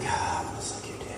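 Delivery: breathy mock-seductive whisper; very soft, quick airy murmur. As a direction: whisper it like a come-on in someone's ear. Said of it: "being a little more seductive".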